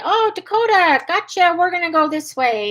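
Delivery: cheerful redirect to the puppy; upbeat dog-calling voice. Shown as a demonstration.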